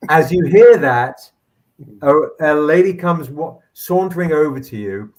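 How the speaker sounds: ordinary talk, nothing marked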